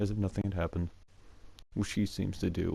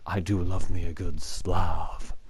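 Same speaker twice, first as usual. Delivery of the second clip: louder, slower teacher imitation; in-your-face teacher; 2 semitones lower, 6 dB louder, slower than usual. Imitating teacher right up in Quentin's face, mock-intense. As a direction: mimic a teacher savoring each word, pushed louder. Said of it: "gets right up in his face and goes".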